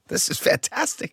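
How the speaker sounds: high, soft, breathy thrill; delighted kid. Retold or imitated. Boy amazed walking into summer school, hushed delight. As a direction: gasp it out with joy.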